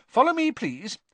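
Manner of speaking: high, brisk teacher's command; strict schoolmistress giving orders; Professor McGonagall. A narrator's reading. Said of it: "said Professor McGonagall".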